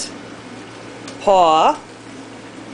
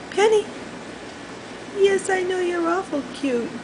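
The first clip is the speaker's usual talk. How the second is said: high soft coo; 8 semitones higher, 6 dB quieter than usual. Real, not acted. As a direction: coo at the bird. Cooing to bird.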